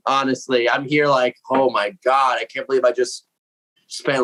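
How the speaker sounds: ordinary talk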